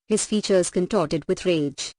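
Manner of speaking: plain reading voice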